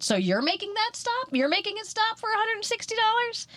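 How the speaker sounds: very high, disbelieving retort; can't believe it, voice soaring; incredulous. Retold or imitated. Throwing the salesman's words back at him, incredulous.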